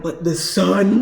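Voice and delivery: slow, loud mock whine about a friend's gripe; laughing imitation of a complainer; imitated complaint. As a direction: drag it out, teasing a pessimistic friend. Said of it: "Dominic's like".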